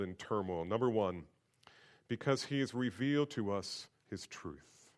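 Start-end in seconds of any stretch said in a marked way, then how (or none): none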